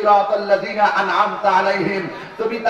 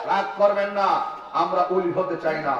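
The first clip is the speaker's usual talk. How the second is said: softer, low and calm; dropping softly; 6 semitones lower, 6 dB quieter than usual. Real, not acted. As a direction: pull right back to a gentle hush. Softens after shout.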